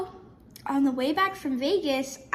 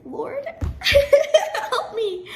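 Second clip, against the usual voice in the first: high, slow laughing prayer through giggles; laughing plea; 14 semitones higher, 7 dB louder, much slower than usual. Retold or imitated. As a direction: laugh out a mock desperate plea to God. Desperate plea retold with laughter.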